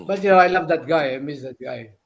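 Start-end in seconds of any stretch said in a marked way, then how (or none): none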